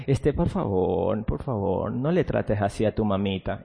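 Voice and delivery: mock-timid mother pleading with her son; timid pleading parent. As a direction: beg weakly like a parent with no authority. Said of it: "Si ves la diferencia de, por favor".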